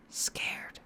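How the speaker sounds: a single frightened whispered word. A narrator's reading. Frightened patient answering her therapist, whispering. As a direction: breathe it out, barely audible. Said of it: "then suddenly a whisper".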